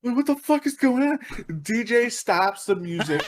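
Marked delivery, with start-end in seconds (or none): 0.0-1.2 high, mock-alarmed question; freaked out. Dancers seeing a stranger on the chair, freaked out. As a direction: imitate bewildered alarm, pitched right up. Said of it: "most freaked out looks on their face".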